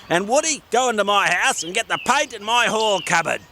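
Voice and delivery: high, bossy little gnome; cheerful small gnome with a plan; Gordon the gnome. Acted. Gordon gives orders.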